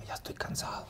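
soft, breathy whispered thought. Retold or imitated. Actor recalling the four-hundredth show, whispered tired thought. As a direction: whisper it like a worn-out inner voice.

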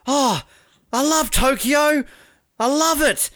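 put-on high, bubbly stoked teen. Ellie Beer gushing about Tokyo, high and stoked. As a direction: gush like a thrilled young sprinter. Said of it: "so stoked".

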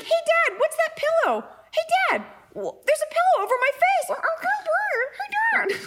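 very high falsetto pet voice; painfully innocent dog. Acted. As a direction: squeak questions to Dad, oblivious to the danger. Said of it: "he's just so painfully innocent".

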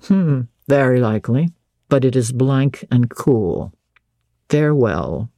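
low, slowing master's voice; Rochester. Acted. Master giving in to a cool farewell, low-voiced. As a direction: pitch it down and draw out the close.

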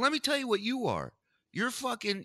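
usual speaking voice

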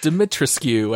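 Dimitrescu with the final U sounded as "cue"; botched "Dimitrescu" ending in a hard U; goofy mispronunciation. Retold or imitated. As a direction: say Dimitrescu as "Di-mit-res-cue". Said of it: "I think there were a few of those".

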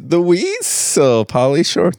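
drawn-out, squealed weasel sound; Weasel impression. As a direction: stretch it like an alien noise. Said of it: "That's like the alien sound".

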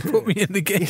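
a manager begging to play himself; mock eager plea. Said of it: "You're like".

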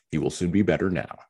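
ordinary delivery

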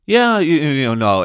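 high, loud mocking impression of a mumbling athlete; exaggerated mock interview voice; mock Russell Wilson. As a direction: ham up a vague, dodging quarterback. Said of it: "the first paragraph is just like".

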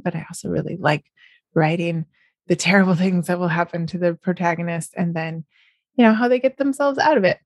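ordinary talk, nothing marked

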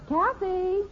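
high-pitched motherly call. Acted. Mother interrupting her chat to call her daughter, calling out. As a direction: call the child sharply by name. Mother calls squabbling child.

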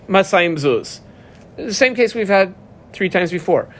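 normal talking voice

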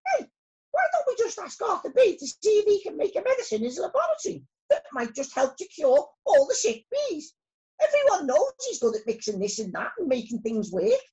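high, lively storybook bee voice; excited high-pitched bee character; eager little bee. A narrator's reading. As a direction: pipe up with a bright idea, quick and loud.

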